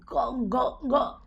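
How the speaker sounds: ordinary talk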